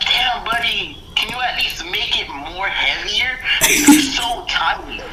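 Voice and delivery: high, drawn-out teasing; mock teasing. Acted teasing of the friend.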